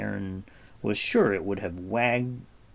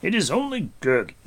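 raised, commanding prince voice; Gwydion's voice; 8 semitones higher, 6 dB louder than usual. A narrator's reading. Gwydion explaining the creature to Taran, in a raised lordly voice. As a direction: speak with lordly assurance.

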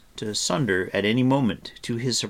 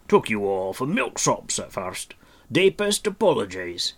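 mock gruff; 2 semitones higher, 6 dB louder than usual. A narrator's reading. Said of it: "attempting to be gruff".

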